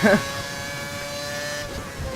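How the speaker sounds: a real, high squeal of laughter at himself; embarrassed high-pitched laugh; high squeaky laugh. Real, not acted. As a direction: let a squeaky giggle burst out. Laughs at his own voice crack.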